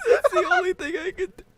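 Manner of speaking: high, fast laugh through tears; laughing hard. Real, not acted. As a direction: still cracking up. Helpless laughing fit.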